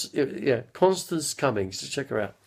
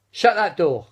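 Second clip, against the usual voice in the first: louder, higher camp call; Larry Grayson catchphrase; 7 semitones higher, 7 dB louder than usual. Pretend. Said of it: "that was Larry Grayson".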